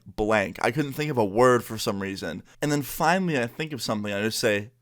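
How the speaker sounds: ordinary talk, nothing marked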